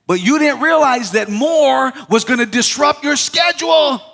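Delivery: high, loud, sung-out preaching; preaching at full force. Acted. Preacher warning the congregation, loud sung-out whoop. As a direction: lift it into a whooping climax. Preaching climax over music.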